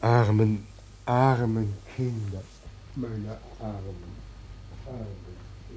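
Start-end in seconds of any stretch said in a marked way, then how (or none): 3.0-5.7 far-off, much softer repeat; distant echo fading to nothing. Acted. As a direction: let it die away in the distance. God's lament fades as echo.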